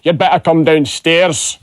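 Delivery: slow, broad Scottish delivery; thick Scottish accent. Acted. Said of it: "in a thick Scottish accent".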